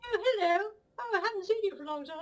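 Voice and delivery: high, gushing mock-posh greeting; pretentious gallery-goer. Mocking art snobs at an exhibition, high gushing voice. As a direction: ham up a pretentious art snob. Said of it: "pretentious wankers at exhibitions".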